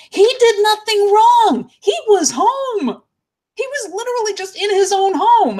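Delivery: real, strained outrage; outraged. Real, not acted. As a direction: plead the victim's innocence. Outraged about searching victim's home.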